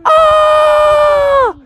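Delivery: high, loud acted scream; frightened scream. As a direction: scream as the cornered frog. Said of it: "And she was like".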